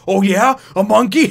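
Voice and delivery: loud, high offended snarl; mock-angry comeback; pissed-off retort. Robot operator pissed off at Toriko, loud mock anger. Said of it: "this thing just get pissed off".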